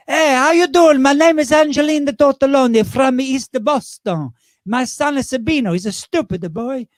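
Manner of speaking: loud, high East Boston Italian widow; brash Boston greeting from a smoky-voiced nonna; Angelina Tortelloni. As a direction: East Boston Italian accent, hammed-up and proud. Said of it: "created a character called Angelina Tortelloni".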